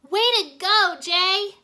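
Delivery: high, loud cheering whoop; excited, loud whoop of praise. Acted. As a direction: cheer him on at the top of your voice. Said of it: "Irene whooped".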